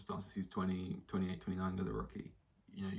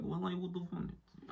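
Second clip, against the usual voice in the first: put-on high, slow Russian-sounding accent; bad Portuguese accent sliding into Russian; botched Mourinho accent; 10 semitones higher, 4 dB quieter than usual. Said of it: "why am I speaking in a Russian accent?".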